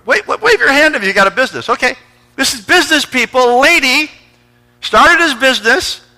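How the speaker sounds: high, shouted suspense build-up; full-force preaching. Acted. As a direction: whip the congregation up before the reveal.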